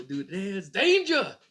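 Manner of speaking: high, loud hammed-up warning cry; mock yell. Streamer imitating the wife's danger call, loud and high. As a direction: sound the alarm over a dirty diaper. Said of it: "You go".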